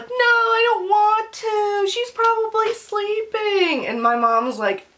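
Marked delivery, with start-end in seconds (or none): 0.0-3.9 high, slow, tearful mock whine; hammed-up pleading wail; imitating whiny protest. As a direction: drag it out like a sulking child. Acts out herself whining to mom.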